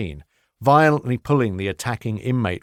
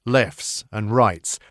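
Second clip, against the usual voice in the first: ordinary delivery, nothing marked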